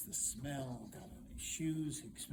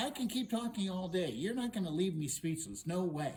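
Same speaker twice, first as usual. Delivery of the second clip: loud, insistent defiance; defiant princess; 6 semitones higher, 7 dB louder than usual. Acted. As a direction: refuse loudly to be silenced. Princess defies the hero.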